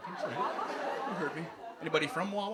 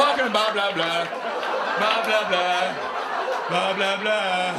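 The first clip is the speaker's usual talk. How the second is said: loud, laughing blah blah chant; loud repeated blah blah; loud jokey greeter; 6 dB louder, slower than usual. Acted. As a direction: ham up the silly name. Playing Bob Loblaw introducing himself.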